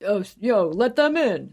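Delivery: retold holler to let friends through; calling out. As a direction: imitate a guy calling over a crowd. Acts out brother calling out.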